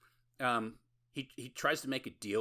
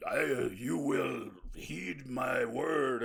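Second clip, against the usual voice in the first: slow, grand vampire command, pitched up; 5 semitones higher, 5 dB louder, much slower than usual. Retold or imitated. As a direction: lord it over her like an old-school vampire. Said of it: "he tries to pull the old".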